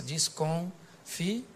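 "desconfiança" very slow, broken by pauses; soft, drawn-out "desconfiança" in separate pieces; syllable by syllable. Shown as a demonstration. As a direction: stretch "desconfiança" out, pausing between the syllables. Repeats the word broken into syllables.

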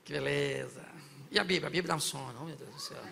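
low, soft groaning sigh; lightly mocked sleepy believer; mock weary churchgoer. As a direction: sigh it out like the Bible bores you. Mock churchgoer sighing at Bible.

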